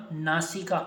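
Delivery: ordinary talk, nothing marked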